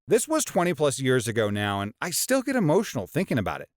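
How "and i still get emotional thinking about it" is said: ordinary delivery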